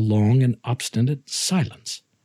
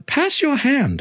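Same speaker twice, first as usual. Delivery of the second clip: raised voice. Acted. Narrator voices Montresor's command.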